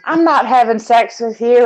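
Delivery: usual speaking voice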